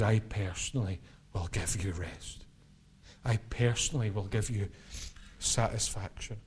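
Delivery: Jesus voiced softly, low and intimate; tender promise. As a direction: speak it like a gentle promise. Voicing Jesus's personal promise.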